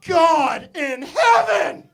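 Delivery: loud, high, slow horrified cry; scandalized shout. Retold or imitated. Customer seeing the atheist book, loud slow shocked cry. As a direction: gasp it out in outrage.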